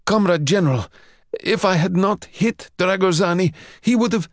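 a junior desperate to justify himself; anxious pleading. Acted. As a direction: Mikhail begging the general to listen. Mikhail pleading with his superior.

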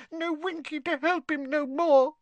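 high, sobbing elf lament; weepy house-elf; tearful elf. A narrator's reading. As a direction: elf grieving alone. Elf weeping for her master.

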